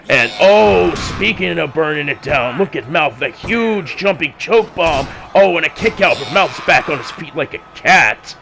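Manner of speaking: rising excitement, calling the big move; excited commentary. Acted. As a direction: hype the move as it lands. Excited wrestling play-by-play.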